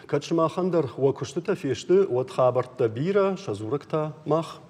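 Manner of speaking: ordinary talk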